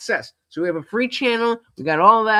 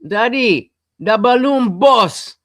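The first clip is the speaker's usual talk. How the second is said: louder and higher, slow, calling out the balloon boss; mock kid catchphrase; 5 semitones higher, 7 dB louder, slower than usual. Son calling his dad with the kids' phrase, loud and slow. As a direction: stretch out balloon boss like the kids did.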